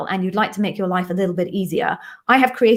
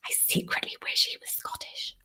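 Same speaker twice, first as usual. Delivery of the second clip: whispered confession; 15 dB quieter than usual. Real, not acted. Secret confession about her husband.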